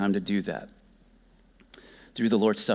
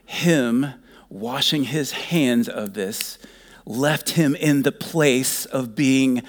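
usual speaking voice

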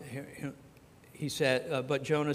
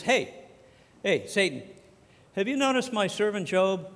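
God calling out; 6 semitones higher, 6 dB louder than usual. Acted.

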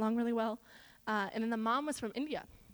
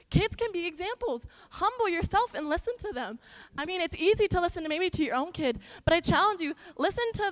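loud, high, fervent sermon delivery; impassioned preaching; 6 semitones higher, 5 dB louder than usual. Real, not acted. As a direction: preach it at full force, voice raised. Preaches at full force.

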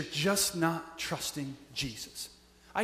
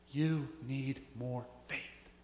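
slow, soft, lower and tender; gentle rebuke; 6 semitones lower, 9 dB quieter, much slower than usual. Acted. Jesus telling the frightened disciples, soft slow rebuke. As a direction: let it land slowly and kindly.

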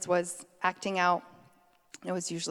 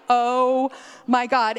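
mortified gasp; 4 semitones higher, 8 dB louder, much slower than usual. Retold or imitated. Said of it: "and we were like".

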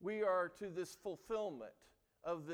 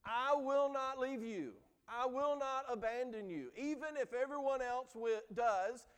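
Peter's vow, said higher and louder; emphatic vow; 6 semitones higher, 5 dB louder than usual. Retold or imitated. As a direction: swear it with full conviction, each word pressed. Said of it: "told Jesus emphatically".

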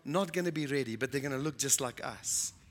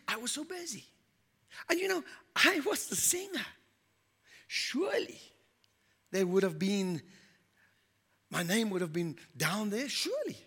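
tearful pleading; 6 semitones higher than usual. Acted. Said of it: "then she started to weep".

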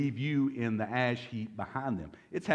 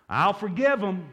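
louder and higher, slowed, mock-generous; mock grudging vow; 7 semitones higher, 7 dB louder than usual. Preacher voices a grudge-holder to the congregation, mock grudging. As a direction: play the grudge-holder's grand promise.

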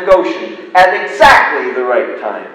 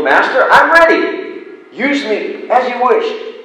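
higher, louder eager reply; bamboo's eager voice; 9 semitones higher, 4 dB louder than usual. Acted. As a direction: answer the master eagerly. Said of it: "Bamboo's voice came low".

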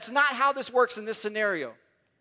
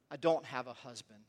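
quiet, pained confession; a hushed, hurting admission; mournful; 7 semitones lower, 7 dB quieter than usual. A narrator's reading. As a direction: say it softly, carrying old shame. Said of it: "you could hear the mourning in her voice and the pain".

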